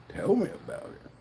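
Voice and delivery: breathy whispered aside. Acted. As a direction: mutter it under the breath.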